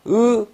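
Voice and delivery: 으 as a forcefully voiced single sound. Shown as a demonstration. Teacher showing students the old ssang-ieung sound, forceful 으. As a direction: say 으 with force, held. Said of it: "이응을 힘있게".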